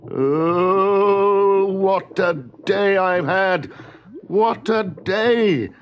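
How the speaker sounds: high, drawn-out comic wail. A narrator's reading. Husband in the pot bemoans his day, wailing. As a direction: wail it loudly, stretching every word.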